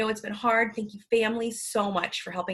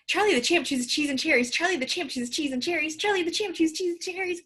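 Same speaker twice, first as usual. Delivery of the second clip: rapid-fire tongue twister, pitched up; fast cheeky rabbit; 6 semitones higher than usual. Shown as a demonstration. Teacher tries a tongue twister three times fast, cheeky rabbit speed. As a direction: race through it high and fast, like a cheeky rabbit. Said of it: "three times fast like a cheeky rabbit".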